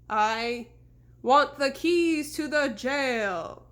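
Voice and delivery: slow, loud looming phantom voice; ghostly horseman. A narrator's reading. Fake horseman offering a bargain, slow and ghostly. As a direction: bargain like a patient spirit.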